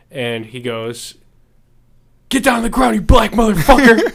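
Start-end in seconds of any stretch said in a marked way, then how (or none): 2.3-4.1 high, loud barking cop yell; cop shout. Acted. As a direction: scream it like a cop making an arrest. Kid imitates a cop, punchline.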